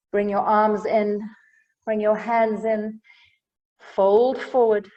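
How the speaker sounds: ordinary talk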